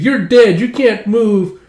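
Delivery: high play yell, stretched at the end; kid's bossy shout. Retold or imitated. Child to a fallen playmate, bossy shout. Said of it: "are all playing pretend".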